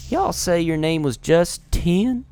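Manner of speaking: a puzzled Southern fighter questioning Justin; Southern accent. Acted. Gamer voicing Milda, slow Southern accent. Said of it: "southern and whatever accent".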